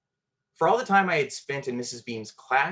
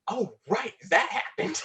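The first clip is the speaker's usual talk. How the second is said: high, bright mock realization; as if a memory just popped up; sudden recall; 11 semitones higher than usual. Storyteller recalling a forgotten moment, mock surprised and high.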